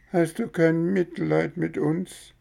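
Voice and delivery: very high, rising, pitiful; whining plea. Acted. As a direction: beg for pity. Fox begs for pity.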